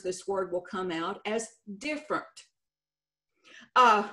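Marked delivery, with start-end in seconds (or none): 1.8-2.2 "different" with its middle syllable dropped and letters swapped; transposed letters. Shown as a demonstration. Coach showing how Texans mispronounce a word, transposed letters. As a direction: say "different" the muddled Texas way, as "diffrnt". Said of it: "we sometimes transpose some letters".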